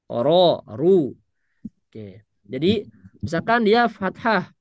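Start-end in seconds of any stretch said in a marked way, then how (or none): 0.0-1.2 "Ro, ru" dengan pangkal lidah naik, huruf r tebal. Shown as a demonstration. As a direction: ucapkan "Ro, ru" tebal dan berat. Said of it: "yang tebal dia pasti kondisi lidah pangkalnya dia naik". (ro dibaca tebal, pangkal lidah diangkat)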